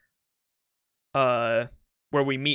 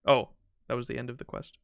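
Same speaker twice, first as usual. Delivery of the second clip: usual speaking voice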